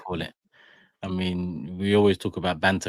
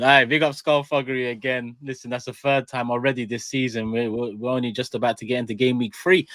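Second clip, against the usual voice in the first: usual speaking voice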